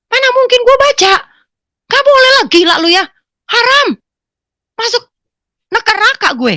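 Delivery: very high, loud protest; retold outraged outburst; indignant refusal. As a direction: refuse loudly, voice shot up high.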